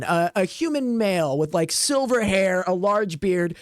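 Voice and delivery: ordinary talk, nothing marked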